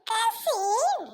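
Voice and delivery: "கசின்" drawn out slowly to correct; corrected pronunciation. Acted. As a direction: say "கசின்" slowly, with a clear opening k. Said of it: "அதுக்கு ஏன் சசின் குசின்ட்டு கிடக்குற?". ("கசின்" என்று நீட்டித் திருத்தம்)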